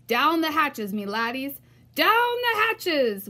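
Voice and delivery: loud, rowdy toast; pirate yell. A narrator's reading. Captain yelling a toast at dinner, loud and rowdy. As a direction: bang the table and bellow it. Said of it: "pounded his fist on the table and yelled".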